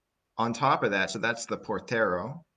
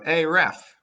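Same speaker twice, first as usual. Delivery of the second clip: loud, high shout of "Hey, ref."; yelled at the ref; 9 semitones higher, 9 dB louder than usual. Retold or imitated. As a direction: bark it at the referee over the crowd. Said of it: "you go".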